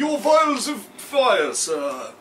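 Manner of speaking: high, slow, plummy butler; posh butler voice. Pretend. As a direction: announce it like an eager butler. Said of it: "should sound like a posh bloke, like a butler".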